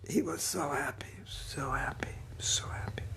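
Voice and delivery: whispered, savoring each repeat; hushed awe. Real, not acted. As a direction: whisper it softly with reverence. Awed retelling of guru's joy.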